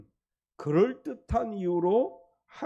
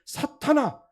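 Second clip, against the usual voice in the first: higher, louder sharp call; Jesus rebuking Peter, raised stern call; stern rebuke; 6 semitones higher, 5 dB louder, slower than usual. Retold or imitated. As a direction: snap out the rebuke. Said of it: "이렇게 말씀하셨어요".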